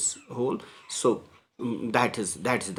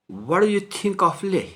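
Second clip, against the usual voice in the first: put-on high, louder questioning girl's voice; a raised, lively girl's question; Italian girl asking; 9 semitones higher, 5 dB louder than usual. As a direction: ask it brightly as the curious tourist.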